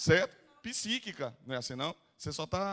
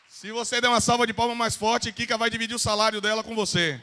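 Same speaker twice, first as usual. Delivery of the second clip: animated crowd hype; 4 semitones higher, 6 dB louder than usual. Real, not acted. Hyping the audience for applause.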